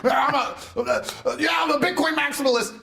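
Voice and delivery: mocking crypto bro. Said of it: "Putting up a Bitcoin symbol".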